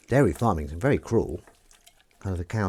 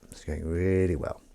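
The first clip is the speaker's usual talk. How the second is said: low, dry delivery stretching "really"; 5 semitones lower, 6 dB quieter than usual. Real, not acted. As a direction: linger long on the word "really". Dry repeat, really drawn out.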